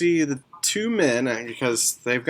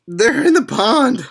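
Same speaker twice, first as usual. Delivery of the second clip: much higher and louder frantic repeat; panicked insistence; 12 semitones higher, 8 dB louder than usual. Acted. Elf repeating where his family went, frantic and louder. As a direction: insist desperately, near a shout.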